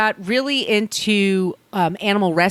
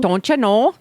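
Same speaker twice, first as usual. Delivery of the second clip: a hammed-up Minnesota "Don't you know?"; lightly sing-song Minnesota accent, rising at the end; terrible Minnesota accent; 3 semitones higher, 3 dB louder than usual. Podcast host jokes about guest's home state, bad Minnesota accent. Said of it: "that's my terrible Minnesota accent".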